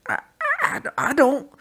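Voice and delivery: high, loud panicked stammer. A narrator's reading. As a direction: stumble over the words in fear. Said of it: "managed to stammer".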